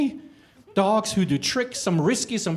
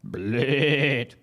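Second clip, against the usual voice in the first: drawn-out animal noises; mock animal bleat and neigh; 2 semitones lower, 5 dB louder, much slower than usual. The other animals answering the creature with bleats. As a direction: stretch it like sheep and horse. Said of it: "And the others".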